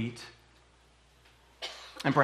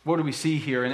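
usual speaking voice

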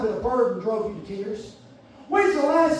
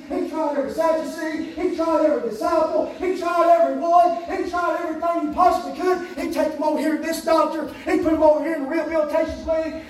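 driving, rhythmic sermon cadence; full-force preaching; 5 semitones higher, 5 dB louder than usual. Acted. Preacher recounting a father's desperate search for his boy, impassioned. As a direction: hammer each repeated phrase like a revival preacher.

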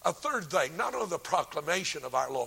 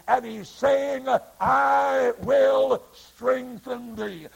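preaching at full force; 7 semitones higher, 6 dB louder than usual. Acted. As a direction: build to a thunderous proclamation.

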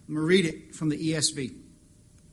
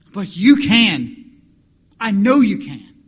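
slow, much louder "But you can"; loud confident plea; 4 semitones higher, 15 dB louder, slower than usual. Acted. Father insists to Jesus, loud and certain. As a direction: declare it with full certainty.

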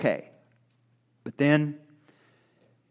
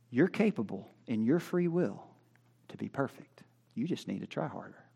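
soft, low-key telling; understated persuasion; 3 semitones lower, 9 dB quieter than usual. Acted. Preacher voicing Pelagius to the congregation, soft and understated. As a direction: say it quietly, as simple reasonable advice.

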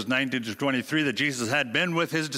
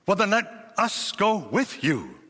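lightly voiced eager disciple, slowed down; a hint of a loyal follower ready to go; loyal Thomas; 5 semitones higher, slower than usual. Preacher voicing Thomas pledging to follow Jesus, lightly loyal. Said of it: "in his loyalty".

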